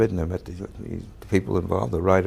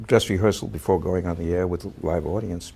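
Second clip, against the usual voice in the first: usual speaking voice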